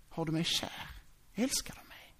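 soft, hushed, near-whispered ask; tender question. Acted. Jesus to Petrus after the resurrection, hushed and tender. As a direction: ask gently, barely voiced.

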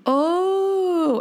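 waiter realizing. Retold or imitated. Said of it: "No, I said cola".

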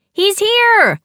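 high, loud announcement; excited call. A narrator's reading. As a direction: call out the arrival with glee. Nicole spots Jude arriving.

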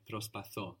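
προσπαθώ, stressed on its last syllable; stress on the ending. Shown as a demonstration. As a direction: land the accent on the ώ of προσπαθώ. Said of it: "the accent is on the ending".

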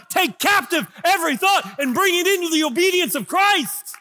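loud, high, ringing sermon voice; shouting the declaration from the pulpit; full-force preaching. Acted. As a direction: preach it out to the whole room.

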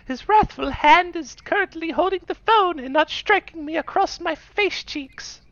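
put-on very high, meek child voice; slow, dramatic frightened child; timid child. Voicing the family child.

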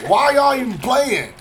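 exasperated question. Retold or imitated. Parent scolding retold.

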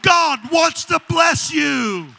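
shouted, sung preaching over music; full-force preaching. Real, not acted. Preacher shouting blessings to the congregation, sung and loud. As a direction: belt it out like a sermon climax.

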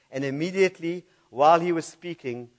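ordinary talk, nothing marked

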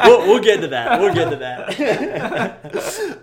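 loud, high, words breaking up in laughter; real laughing. Real, not acted. Storyteller promises the cop part later, really laughing. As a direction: crack up while putting the topic off.

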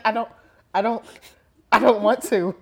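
loud, protesting reluctance; mock tearful refusal. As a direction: play at refusing through tears. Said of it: "I'll be, like, in tears".

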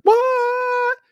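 high squeaky disbelief; a yelp of pure shock; stunned what. Retold or imitated. The crowd reacting in shocked disbelief. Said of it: "They're like".

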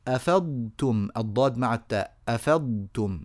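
أفضتم with ض and ت kept apart; clear ḍād before tāʾ. Shown as a demonstration. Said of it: "هكذا نبين الضاد من التاء".

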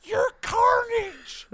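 thin squeal, stretched out word by word; Andy Serkis impression. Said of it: "quick impression of Andy Serkis".

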